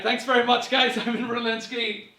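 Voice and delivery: ordinary talk, nothing marked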